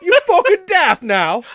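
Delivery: high, loud and slow, laughing through a fake British voice; bad mock British accent. Hosts riffing on the cartoon T-Rex, laughing mock British accent. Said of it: "the worst accent possible".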